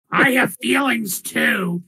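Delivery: high, puffed-up silly voice; the Minotaur insists on being named, in a high silly voice; proud Minotaur. Acted. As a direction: boast your mighty name. Minotaur protests.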